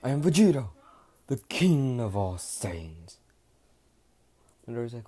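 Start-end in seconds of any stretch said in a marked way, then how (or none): 0.0-3.2 loud, slowed royal declaration; grand proclamation. Acted. Vegeta redoes his royal introduction, slower and grander. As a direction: draw out king of all Saiyans. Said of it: "Let me repeat that again".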